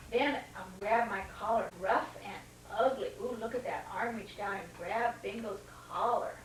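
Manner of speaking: usual speaking voice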